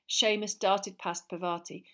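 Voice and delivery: ordinary delivery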